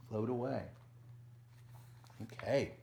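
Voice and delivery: usual speaking voice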